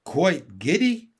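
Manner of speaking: slow, loud, rising question; courtiers' incredulous chorus; astonished echo. A narrator's reading. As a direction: echo the king in disbelief. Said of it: "echoed all the courtiers".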